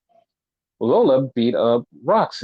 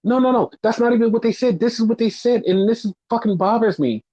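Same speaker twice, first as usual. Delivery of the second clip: higher, a fed-up heated rant; exasperated rant; 7 semitones higher than usual. Real, not acted. Podcaster venting to cohost about tag team storyline, exasperated. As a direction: let the frustration pour out.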